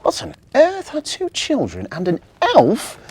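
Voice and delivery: a shocked mum at the door; astonished mum. Acted. Timmy's mummy finds children at night, amazed and loud.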